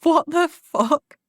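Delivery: stunned disbelief. Retold or imitated. Girls watching the old lady chase the man, stunned and drawn out. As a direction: draw it out, gobsmacked. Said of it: "we were like".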